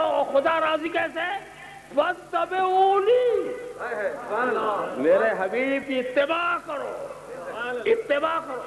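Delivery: very high, slow, shouted recitation; impassioned preaching. Acted. Preacher urging followers, very high and slow. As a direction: cry out the verse slowly.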